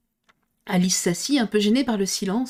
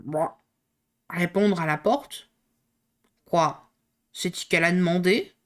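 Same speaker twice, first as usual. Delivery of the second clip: hoarse frog; 2 semitones lower than usual. Acted. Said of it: "si enrouée".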